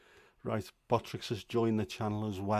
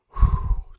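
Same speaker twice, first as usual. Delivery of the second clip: big loud puff of breath; heavy exhale; 16 dB louder than usual. Real, not acted. Streamer catching breath after rushing back, heavy exhale. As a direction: blow out air hard, winded.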